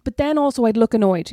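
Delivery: normal talking voice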